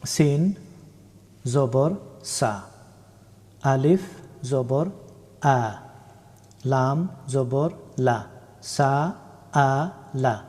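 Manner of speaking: letter by letter, naming সিন, জবর, আলিফ, লাম then the syllables সা, আ, লা; teacher spelling Arabic letters with fatha slowly. Shown as a demonstration. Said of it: "কয়েকটা উদাহরণ দেখে নেই".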